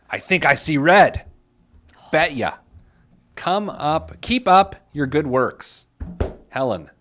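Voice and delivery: emphatic, punched read of an angry letter; hammed-up righteous letter-writer, pausing for effect; indignant letter reading. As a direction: read it out with mounting indignation, leaning on key words.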